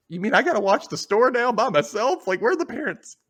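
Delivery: high, mock-outraged protest; indignant complaint. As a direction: protest as if left in charge unfairly. Acted indignant complaint about absent parents.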